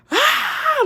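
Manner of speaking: high piercing startled scream; scream of fright. Retold or imitated. Woman startled in the mirror, screaming. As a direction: shriek at the figure in the mirror. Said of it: "I couldn't stop screaming".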